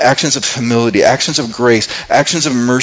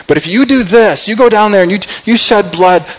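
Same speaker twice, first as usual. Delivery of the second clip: urgent warning; 7 semitones higher than usual. Retold or imitated. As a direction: raise the voice to stop him before it's too late. Abigail warning David, preacher voicing her.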